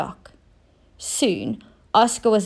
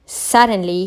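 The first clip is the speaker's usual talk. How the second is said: usual speaking voice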